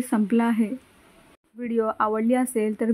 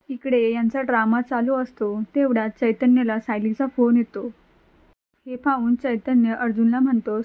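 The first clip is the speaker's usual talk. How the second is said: ordinary talk, nothing marked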